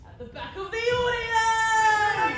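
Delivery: booming and drawn out, slow; a host's big, slow reveal; grand announcement. Acted. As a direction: announce it to the whole hall. Host announcing hobbits' arrival.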